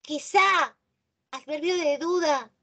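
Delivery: normal talking voice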